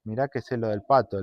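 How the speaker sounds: low, soft taunting aside; said under her breath. Acted. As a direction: murmur it so mum can't hear. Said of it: "le dice por lo bajo". (dicho por lo bajo, burlón)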